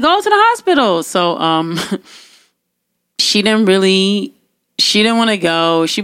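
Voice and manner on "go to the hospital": high, quick, insistent command; exasperated order. Retold or imitated. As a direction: re-enact shooing a sister off to go. Retells exasperated order to sister.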